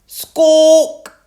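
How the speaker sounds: loud, high parrot squawk. A narrator's reading. Polly the parrot pipes up with a loud squawk. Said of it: "Polly copied".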